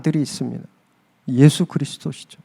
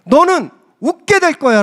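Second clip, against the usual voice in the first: scolding promise; 9 semitones higher than usual. Retold or imitated. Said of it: "사라를 혼내시면서".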